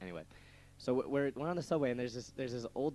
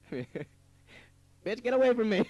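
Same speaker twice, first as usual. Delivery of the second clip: much higher, much louder yell; shouted brush-off; 10 semitones higher, 10 dB louder, much slower than usual. Retold or imitated. Telling the bag lady off, shouting high and loud. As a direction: yell it to drive her away. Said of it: "I said".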